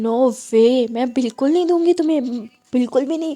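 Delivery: loud, flat-out no from mom; a mother loudly refusing her daughter the keys; firm refusal. Acted. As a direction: slam the door on the request.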